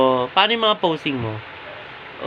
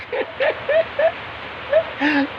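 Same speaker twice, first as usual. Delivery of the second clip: high laugh; 4 dB quieter than usual. Real, not acted. Laughing at zombie child.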